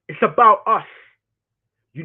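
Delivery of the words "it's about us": emphatic repeat. Shown as a demonstration. Repeats line, second time louder.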